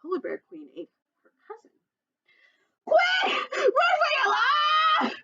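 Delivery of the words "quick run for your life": very high, loud, slow shout. Acted. Fox shouting warning to the polar bear queen. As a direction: yell it in full alarm. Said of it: "shouted Fo-, Foxy Loxy".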